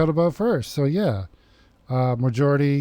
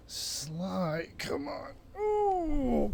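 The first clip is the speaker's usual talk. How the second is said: high squeaky voice for the pet; 10 semitones higher, 9 dB quieter, much slower than usual. Real, not acted. Calling to pet Sly.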